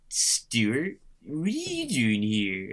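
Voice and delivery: slow, drawn-out Californian valley voice in a blonde-wig sketch; lightly voiced goofy Californian, stretched slowly; Fred Armisen Californian. Podcast host mimicking an SNL sketch character, slow goofy Californian drawl. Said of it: "Fred Armisen is just like".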